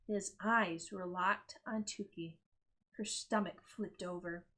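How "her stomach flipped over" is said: ordinary delivery, nothing marked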